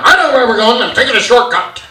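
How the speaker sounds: loud stubborn dad. Retold or imitated. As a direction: bark it stubbornly. Dad snaps back.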